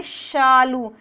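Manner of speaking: शालू with tongue on palate, a full sh; palatal sh. Shown as a demonstration. Teacher modelling शालू, sh not s. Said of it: "हम सालू नहीं करेंगे".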